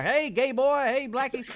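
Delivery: high puppet calling out at people; puppet heckling passersby. Retold or imitated. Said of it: "insulting people as they go by".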